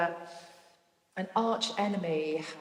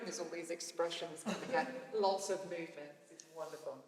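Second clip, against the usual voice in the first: ordinary talk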